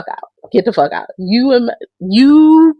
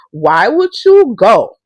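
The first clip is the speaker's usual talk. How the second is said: ordinary talk, nothing marked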